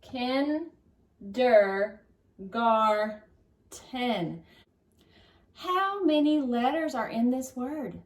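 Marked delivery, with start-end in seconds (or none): 0.0-4.4 KIN, DER, GAR, TEN with a clear pause after each; syllable by syllable. Shown as a demonstration. Teacher sounds out a long word for pupils, KIN, DER, GAR, TEN separated. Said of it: "Let's put it all together".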